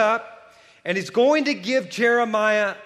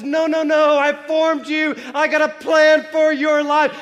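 much higher and louder, firm and urgent; God insisting; 9 semitones higher, 6 dB louder than usual. Retold or imitated. God firmly correcting Jeremiah's excuses, loud and high. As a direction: cut him off with God's insistence. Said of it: "And God says".

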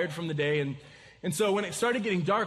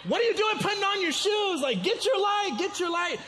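high, exasperated holler; re-enacted frantic yell, pitched way up; retold panicked yelling; 13 semitones higher than usual. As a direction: yell at him in mock disbelief and panic. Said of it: "in a panic".

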